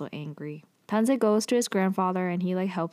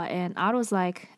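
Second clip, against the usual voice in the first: usual speaking voice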